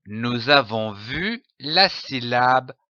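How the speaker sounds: ordinary talk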